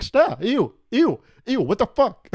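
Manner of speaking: high, loud disgusted shriek; grossed out. Retold or imitated. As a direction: recoil and shriek ew at it. Disgusted at flirting MacBook.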